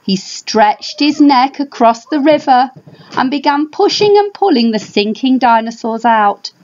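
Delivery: usual speaking voice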